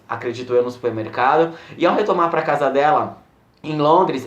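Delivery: ordinary talk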